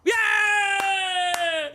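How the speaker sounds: loud high cheer; excited shout. Real, not acted. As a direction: shout with joy.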